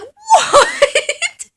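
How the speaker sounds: high, loud laughing what; startled laughing what; laughing shout. Real, not acted. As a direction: yelp it out.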